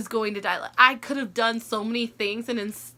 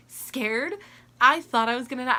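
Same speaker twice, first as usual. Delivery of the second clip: usual speaking voice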